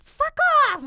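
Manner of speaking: high, loud, furious snap; angry outburst. Retold or imitated. Retelling snapping at mom over her texts, furious. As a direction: blow up at her. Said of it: "I was just so mad".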